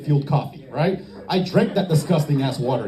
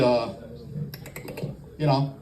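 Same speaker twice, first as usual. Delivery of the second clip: ordinary talk, nothing marked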